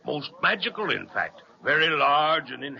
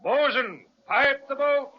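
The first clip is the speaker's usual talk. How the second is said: shouted order; 11 semitones higher than usual. Acted. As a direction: sing it out across the deck. Captain orders the crew.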